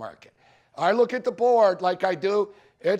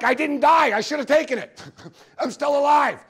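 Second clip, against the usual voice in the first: much higher and louder mock glee, a laugh at the end; gleeful relief; 7 semitones higher, 6 dB louder than usual. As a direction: crow that your bet survived. Own bet still alive, excited.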